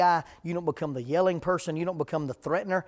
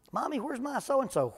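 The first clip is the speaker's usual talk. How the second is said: higher, lightly voiced small child; little kid asking; 6 semitones higher, 3 dB quieter than usual. Child asking mommy where the toy went, higher kid voice. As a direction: whine like a toddler missing a toy.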